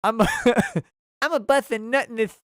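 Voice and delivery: lisping boxer impression, breaking into laughter; Mike Tyson. Streamer reading out the kangaroo joke, lisping impression. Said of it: "Mike Tyson making the first kangaroo says".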